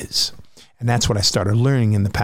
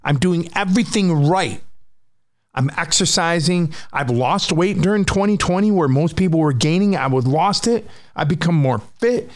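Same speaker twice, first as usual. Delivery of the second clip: higher-pitched, frustrated outburst with climbing pitch; exasperated rant at panic; 6 semitones higher than usual. Retold or imitated. Speaker rants at his returning panic disorder, exasperated and higher. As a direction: yell at the panic for coming back. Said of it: "And I'm like".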